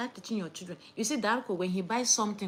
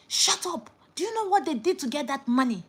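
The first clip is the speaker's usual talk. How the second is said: higher and louder, cutting them off; sharp scolding; 6 semitones higher, 4 dB louder than usual. Real, not acted. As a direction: shut them up firmly. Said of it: "scolds the viewer".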